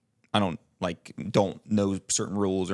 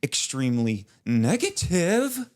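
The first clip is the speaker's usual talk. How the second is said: slow, high, stretched-out delivery; theatrical Dwight line; 7 semitones higher, slower than usual. Retold or imitated. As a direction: overplay the line like a TV actor. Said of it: "It's, like, very theatrical".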